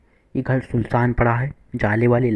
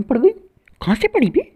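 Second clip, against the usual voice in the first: high, slow startled cry; jolted by a sudden shot; shocked where-from yelp; 5 dB louder, slower than usual. Real, not acted.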